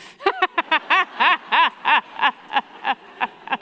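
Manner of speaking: loud high laughter rolling on; real laughter; long laugh. Real, not acted. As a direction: laugh hard at the absurd word. Laughs at "our slave.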